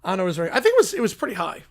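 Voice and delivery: ordinary talk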